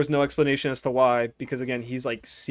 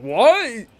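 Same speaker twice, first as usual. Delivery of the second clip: shocked what; 9 semitones higher, 9 dB louder than usual. Retold or imitated. Kid shocked by mom's reveal.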